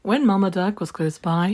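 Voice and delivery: usual speaking voice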